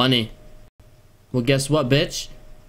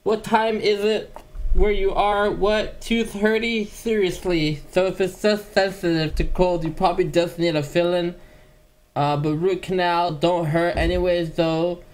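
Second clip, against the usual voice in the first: higher, slow lisping boxer voice; Mike Tyson impression; 4 semitones higher than usual. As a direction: do the famous boxer, high and slow, friendly. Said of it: "It's me, Mike Tyson".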